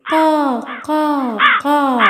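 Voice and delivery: slow, drawn-out caws; crow imitation. Shown as a demonstration. Teacher mimics how the crow cries, cawing. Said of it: "ശബ്ദങ്ങളൊക്കെ ഒന്ന് അനുകരിച്ചാലോ".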